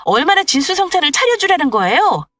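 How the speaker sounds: angry daughter-in-law. Acted. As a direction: snap back at the mother-in-law. Wife fights back at mother-in-law.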